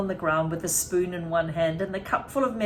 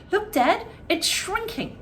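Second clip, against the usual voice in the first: put-on alarmed boy; 11 semitones higher than usual. A narrator's reading. George calling to his dad as the hen shrinks, high and alarmed. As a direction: point it out in a high, urgent voice.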